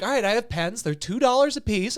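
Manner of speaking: high-pitched mock salesman. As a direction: pitch it high, like a door-to-door seller.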